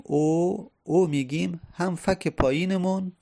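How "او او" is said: rounded, held "او" as a long oo; damma vowel. Shown as a demonstration. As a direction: round the lips and sound out "او".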